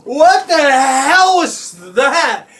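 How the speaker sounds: slow, drawn-out angry bellow; storming parents. Retold or imitated. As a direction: roar it high and loud, stretching each word. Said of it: "are storming down the fucking hallway".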